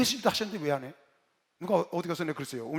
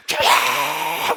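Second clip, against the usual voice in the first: huge, long-held roar; powerful prayer cry; 4 semitones lower, 9 dB louder, much slower than usual. Retold or imitated. Seminary president leading the prayer call, sustained powerful shout. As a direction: let the cry swell and hold it at full force. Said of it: "근데 보니까 우리 총장 말씀은".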